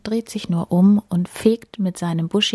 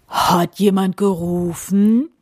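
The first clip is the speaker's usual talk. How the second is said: booming wind voice; 3 dB louder than usual. A narrator's reading. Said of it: "dröhnt der Wind plötzlich mit seiner tiefen Stimme".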